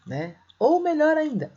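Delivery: ordinary talk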